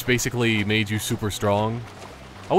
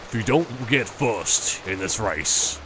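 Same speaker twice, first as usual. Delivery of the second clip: lightly voiced mob boss, slow and measured; a slow, calm threat, just a hint of gangster; understated new-age mob boss threat; 2 semitones lower, 3 dB quieter, slower than usual. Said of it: "the mob boss sounds like that".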